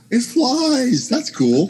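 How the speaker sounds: high, thrilled exclaim; excited. Retold or imitated. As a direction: squeal with delight. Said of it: "I was like".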